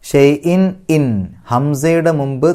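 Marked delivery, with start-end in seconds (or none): 0.0-1.0 slow ഷൈഇൻ with the n sounded openly; clear n. Shown as a demonstration. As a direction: let the n of ഇൻ ring out clearly. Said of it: "വെളിവാക്കണം". (ഷൈഇൻ ഇൻ: നൂൻ വെളിവാക്കി പറയുക)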